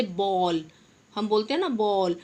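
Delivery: बॉल with an open aw sound, neither aa nor o; बॉल with a rounded open vowel; open o demo. Shown as a demonstration. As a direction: say बॉल like English ball. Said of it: "अब ओ की आवाज़ आ रही है न".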